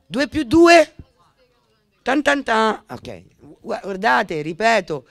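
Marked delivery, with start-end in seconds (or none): 0.1-0.9 much higher and louder, triumphant; spring the question on them like a reveal; excited rhetorical question. Real, not acted. Speaker to the listeners, triumphantly rhetorical. 2.0-2.8 dramatic drumroll sound. Real, not acted. Dramatic sound effect after reveal.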